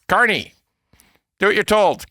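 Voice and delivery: lightly imitated bossy command; Trump. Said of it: "Trump says".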